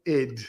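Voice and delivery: "id" said as one word; one-syllable word. Shown as a demonstration. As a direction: pronounce "id" like the psychology term, rhyming with lid. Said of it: "as in ego".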